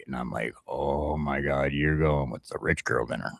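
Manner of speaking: ordinary talk, nothing marked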